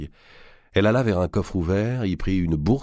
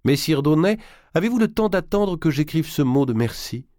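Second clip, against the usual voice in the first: much higher and louder formal question; loud for show; 11 semitones higher, 4 dB louder than usual. A narrator's reading. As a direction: keep up the act for the listener.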